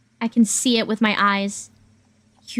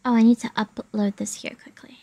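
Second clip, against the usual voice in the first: quiet whisper to self; 4 dB quieter than usual. Real, not acted. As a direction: whisper it under breath. Whispering to herself.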